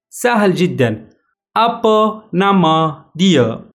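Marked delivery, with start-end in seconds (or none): 1.6-3.7 Apa nama Anda said very slowly, word by word; slow teacher demo; slow model phrase. Shown as a demonstration. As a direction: spell it out slowly for learners to repeat. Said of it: "مرة أخرى".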